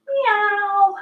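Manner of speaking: high squeaky cat meow. Pretend. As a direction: meow like a playful kitten. Kids yoga cat cow sounds.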